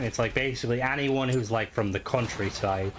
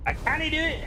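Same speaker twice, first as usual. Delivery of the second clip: much higher, strained mock Scottish accent. Teasing game Scottish voices, in a much higher, strained mock Scottish accent. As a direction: squeeze the line out in a Scottish accent, as if constipated. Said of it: "That is Scottish".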